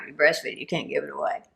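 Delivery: quietly nod along like a fellow dog lover; hushed knowing repeat; soft agreeing echo. Retold or imitated. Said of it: "crazy dog people like me will go".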